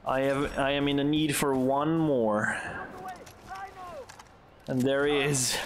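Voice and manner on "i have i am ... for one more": ordinary talk, nothing marked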